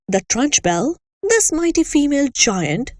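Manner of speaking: ordinary delivery, nothing marked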